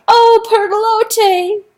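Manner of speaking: long, high, loud cry for help. A narrator's reading. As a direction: cry out the name, drowning. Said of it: "he cried out".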